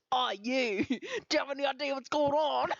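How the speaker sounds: bad British impression. Friends parodying Dorian's line in mock British accent. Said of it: "really shitty impressions of Dorian".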